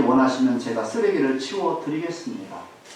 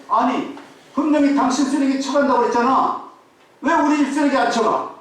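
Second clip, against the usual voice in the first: higher, pressing demand, mildly acted; retold confrontation; 7 semitones higher, 3 dB louder than usual. Isser confronts the sanitation worker, mock indignant. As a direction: confront him as if he broke a promise. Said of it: "막 따지는 거예요".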